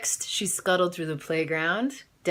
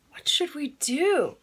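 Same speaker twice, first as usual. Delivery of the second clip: worried question; 9 semitones higher than usual. Acted. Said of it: "asked Missus Hope".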